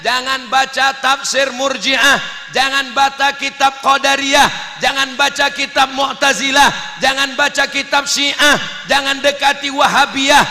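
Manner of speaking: preaching warnings at full force; kiai's stern warning. Acted. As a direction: hammer out each prohibition. Dulu kiai ngatakan.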